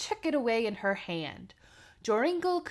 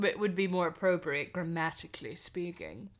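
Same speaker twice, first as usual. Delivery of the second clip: usual speaking voice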